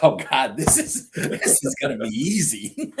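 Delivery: high, laughing "Oh God"; relieved laughing disbelief; laughing relief. Retold or imitated. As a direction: chuckle with relief through it. Laughing relief before surgery.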